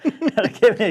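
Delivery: laughing through the words. Real, not acted. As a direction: crack up mid-line.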